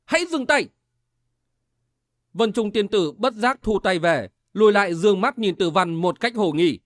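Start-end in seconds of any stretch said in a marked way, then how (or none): 0.0-0.7 high-pitched command without added volume; narrated angry order, pitched well up; angry shout. A narrator's reading. Tử Văn stops the attacking woman, shouting angrily. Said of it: "Tử Văn tức giận quát lên".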